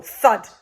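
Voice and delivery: heavy landing sound; loud thud. A narrator's reading. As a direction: punch the word like an impact. Sound effect word.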